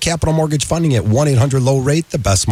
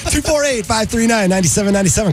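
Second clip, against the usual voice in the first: number read through laughter, higher; laughing through it; 10 semitones higher than usual. Real, not acted. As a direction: still laughing, reading digits. Laughing while reading number.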